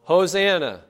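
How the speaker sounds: pastor's loudest call for the congregation to echo; loud Hosanna. Shown as a demonstration. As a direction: lift it like a festal cry. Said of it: "Once again".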